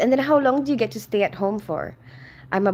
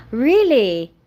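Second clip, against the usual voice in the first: gasping, louder and higher; surprised gasp; 4 semitones higher, 8 dB louder than usual. Real, not acted. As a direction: react with a sharp gasp. Gasps at viewer's results news.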